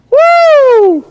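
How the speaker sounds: high, sustained whoop; long high preacher's whoo; excited whoop. Real, not acted. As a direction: whoop at the wonder of it.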